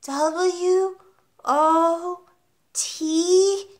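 high schoolboy spelling out letters; child reciting a spelling; little boy. A narrator's reading. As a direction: pipe each letter up high, one by one. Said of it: "Eric said, answering too quickly".

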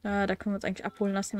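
ordinary talk

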